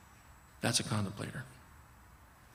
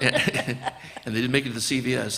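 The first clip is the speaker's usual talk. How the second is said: laughing; 5 dB louder, faster than usual. Real, not acted. Laughing retelling.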